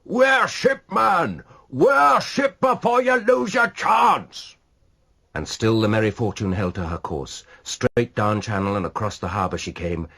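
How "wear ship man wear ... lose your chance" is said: high, loud, slow urgent shout; crying out. A narrator's reading. Godolphin shouting to the ship's helmsman, urgent. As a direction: bellow the warning to the helmsman. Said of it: "Godolphin too added his cry".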